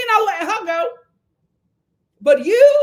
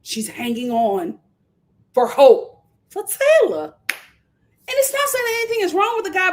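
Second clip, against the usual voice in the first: normal talking voice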